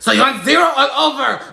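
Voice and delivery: high, loud mock-obliging shout; brusque barber. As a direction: holler it over the customer's wishes. Barber ignores the request.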